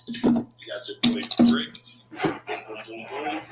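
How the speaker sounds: hype-man introduction, stretching Milwaukee; light ring-announcer build-up; ring announcer intro. Acted. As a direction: announce him like a fighter coming out. Host introduces second debater.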